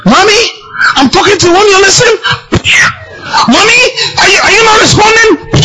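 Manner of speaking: put-on high, crying child calling Mommy, shouting through tears; a small boy wailing for his mommy. Child crying to unresponsive father.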